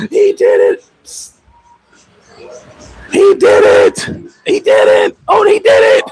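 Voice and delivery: hammed-up crying shout, pitch swinging wildly; mock wailing. Jealous boyfriend at the mirror, hammed-up sobbing. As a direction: fake sob it out like a jealous dude.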